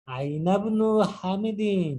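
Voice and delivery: আইনাবনু very slow, said as one word with no hamza; joined ainabnu. Shown as a demonstration. Teacher modelling the joined word, very slow. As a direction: glide aina into bnu without a break. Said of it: "আইনাবনু একসাথে উচ্চারণ হবে".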